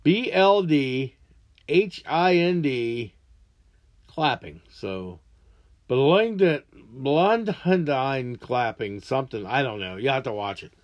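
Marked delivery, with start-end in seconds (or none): 0.0-3.1 letter by letter, deliberate; slow careful spelling of "B L D H I N D". Shown as a demonstration. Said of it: "The way I could spell it was B L D". 6.7-9.0 very slow "Blundhendine clapping", sounding out the garble; fumbling imitation of the mumbled word; drawn-out attempt at "Blundhendine"; trying to pronounce it. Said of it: "I can't pronounce the gibberish he says".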